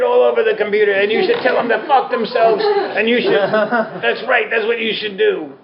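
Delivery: enabling friend. Pretend. Teacher voicing the friend who urges you to quit, fast and high. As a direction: hype them up like a reckless friend cheering every whim. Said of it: "the friend that indulges, enables, and agrees".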